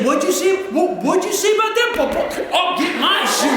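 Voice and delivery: high, mock-furious challenge; hammed-up indignant threat; provoked outburst. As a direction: sputter it like someone itching to fight. Acts out someone provoked into threats.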